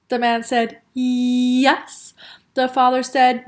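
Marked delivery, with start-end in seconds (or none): 0.9-2.1 drawn-out "yyyes"; stubborn insistence. A narrator's reading. Cashier arguing with father, stubborn. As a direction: stretch the start, then snap it shut.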